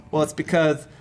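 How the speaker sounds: put-on Southern accent, softening toward the end; thick Southern accent. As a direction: imitate a saucy old Southern regular. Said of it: "thick Southern accent".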